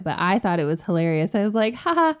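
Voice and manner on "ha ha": high, loud gloating tease; teasing. Retold or imitated. Sister teases her brother about the hospital, high and gloating.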